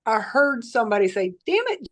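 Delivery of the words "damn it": high, short exasperated mimicry; mimicked curse. Patient imitating the voice heard from bed, high mock-frustrated curse. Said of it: "just like that".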